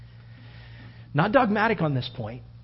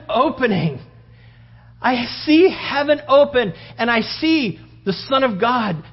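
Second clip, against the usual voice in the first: high, loud awestruck cry; dying martyr crying out his vision; Stephen's vision; 8 semitones higher, 6 dB louder than usual. Retold or imitated. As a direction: call out in wonder at the sight.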